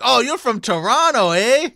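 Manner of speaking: high, loud giggling mock Canadian; laughing through a Canadian eh; giggling Canadian accent. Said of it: "Start giggling".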